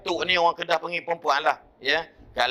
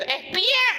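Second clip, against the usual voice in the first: very high mock old woman; grandmother's voice. Grandmother insisting the boy went, very high mock voice. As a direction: squeak it like a fussy granny. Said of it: "Tok kata".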